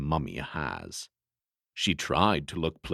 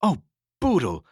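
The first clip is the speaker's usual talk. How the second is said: choked voice; 11 semitones higher, 4 dB louder than usual. Acted. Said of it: "with a choked voice".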